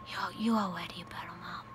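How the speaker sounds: soft whispered reassurance. Retold or imitated. Son whispering comfort to mom during prayer. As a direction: breathe it gently from the dark. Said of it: "I hear him whisper".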